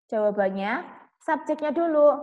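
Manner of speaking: ordinary talk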